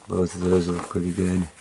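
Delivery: normal talking voice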